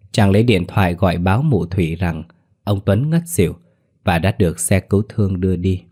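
ordinary delivery, nothing marked

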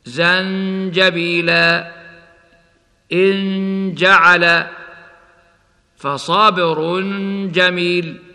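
slow زنجبيلا, humming the hidden ن before ج; ikhfa before ج. Shown as a demonstration. Teacher demonstrating ikhfa before ج, slow recitation. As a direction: draw out the ن of إن جعل in chanted recitation. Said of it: "هكذا".

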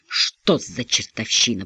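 low, slow, hoarse croak. Acted. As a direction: rasp it out slowly. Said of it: "прохрипел Донни".

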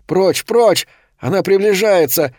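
high, urgent outcry; rising panic. A narrator's reading. Boy fends off the approaching vampire, panicked cry. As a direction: raise the pitch in alarm.

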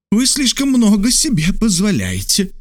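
high, dry, offended retort; indignant. A narrator's reading. Geographer indignant at the major's suggestion, sharp high retort. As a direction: bristle with indignation. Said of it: "возмущённо прервал его учёный".

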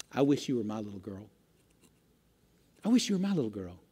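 soft and low, just above a whisper; quietly voiced teacher's confession; hushed, tender quote. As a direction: retell the teacher's words very softly, leaving space. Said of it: "in a voice well above a whisper".

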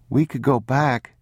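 put-on high, small child voice; soft-spoken boy. A narrator's reading. Boy at the map asking to return, soft high plea. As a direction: suggest it timidly in a child's pitch. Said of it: "the boy said softly".

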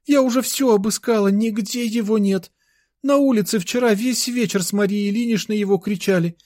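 put-on high, plaintive little girl's voice; distressed child, pitched far above the narration; tearful little sister. A narrator's reading. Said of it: "заплаканный голос сестрёнки". (заплаканный детский голос)

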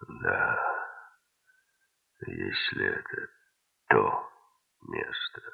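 very low, hushed, near-whispered murmur. A narrator's reading. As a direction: let it out slowly under the breath. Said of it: "прошептал бородач".

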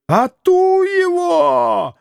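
put-on high, slow shrieking old man; old man's wail. A narrator's reading. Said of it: "завопил старик".

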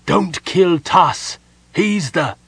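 slow, high, loud warning; urgent shout. Acted. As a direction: shout a desperate warning, cut off. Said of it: "he shouted".

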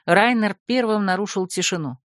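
ordinary delivery

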